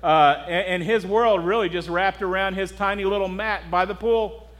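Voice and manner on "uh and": ordinary talk, nothing marked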